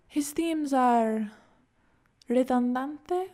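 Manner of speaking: slow, rising, unsure Spanish word; searching for the word. A narrator's reading. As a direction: stretch out "redundante" as a tentative question.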